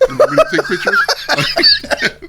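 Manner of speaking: high, slow, breathy words through laughter; laughing mock offer. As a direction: squeak it out while cracking up. Mock call to dispatch, laughing.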